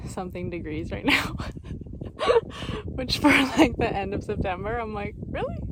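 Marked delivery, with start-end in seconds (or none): 5.3-5.6 much higher rising question; incredulous. Retold or imitated. Hiker reacting to thirty degrees in September, incredulous. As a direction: ask it in pure disbelief.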